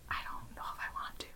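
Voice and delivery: anxious hushed whisper. Real, not acted. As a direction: drop to a worried whisper. Confessing anxiety about returning.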